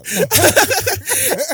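high, loud burst of laughter. Real, not acted. Fan cracking up over a celebrity obsession, real loud laugh.

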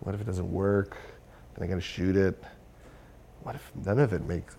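hushed dread, starting in a whisper; low, soft anxious muttering; daunted self-talk. Retold or imitated. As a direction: worry to yourself under your breath. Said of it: "I'm just like".

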